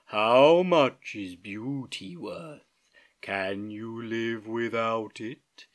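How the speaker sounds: very slow, drawn-out sloth voice; lazy sloth. Acted. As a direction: take all the time in the world. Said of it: "in her deep and lazy voice".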